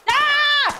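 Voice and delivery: high startled scream; short, high cry of alarm; startled yelp. Real, not acted. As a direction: yelp out "Ah" in surprise. Startled while playing robbery game.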